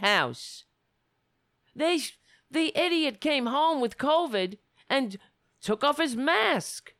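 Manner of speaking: loud, heated tirade; angry rant. Real, not acted. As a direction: rail against them. Angry rant about the president.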